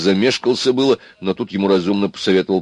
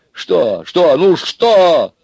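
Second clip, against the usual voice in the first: escalating, higher and louder each time; rising hysteria; 10 semitones higher, 7 dB louder, much slower than usual. A narrator's reading. Аристарх cannot guess the answer, rising hysteria. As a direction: build toward hysterics. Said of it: "кажется, начиналась истерика".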